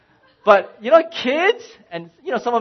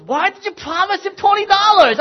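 much higher and louder wife voice; wife scolding her husband, much higher and louder; exasperated wife; 12 semitones higher, 9 dB louder than usual. Retold or imitated. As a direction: demand it like a fed-up wife. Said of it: "and she goes".

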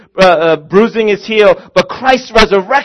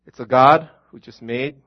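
normal talking voice

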